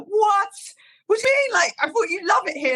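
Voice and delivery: shocked disbelief. Retold or imitated. Manager hearing she's quitting, high shocked disbelief. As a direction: imitate a stunned manager's disbelief.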